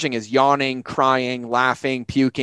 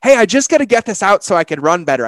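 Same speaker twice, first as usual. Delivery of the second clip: bright, raised voice as the car; playful car voice; 7 semitones higher, 5 dB louder, faster than usual. Pretend. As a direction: play the car asking to vent. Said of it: "my car literally saying".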